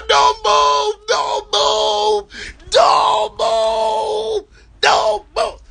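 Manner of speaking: very high, loud frantic yells; screaming commands. Retold or imitated. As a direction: scream it at the man on the ground. Officers yelling at Twitch.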